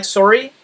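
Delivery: SORRY as "sore-ee"; Canadian sorry. Shown as a demonstration. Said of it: "like a Canadian would".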